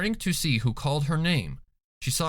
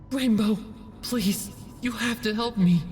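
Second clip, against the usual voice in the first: pleading doll; 9 semitones higher than usual. A narrator's reading. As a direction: beg desperately for help. Doll begs in Fluttershy's voice.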